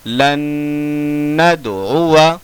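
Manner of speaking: लन नदउआ with a short final अ; लन नदउआ chanted, alif silent; correct recitation. Shown as a demonstration. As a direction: hum the न of लन, end नदउआ short. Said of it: "पढ़ने का तरीका".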